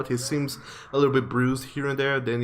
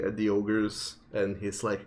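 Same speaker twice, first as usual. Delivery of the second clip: ordinary talk, nothing marked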